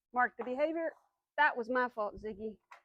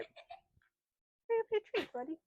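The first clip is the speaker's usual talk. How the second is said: coaxing; 9 semitones higher, 6 dB quieter, much slower than usual. Real, not acted. Coaxing dog to eat treat.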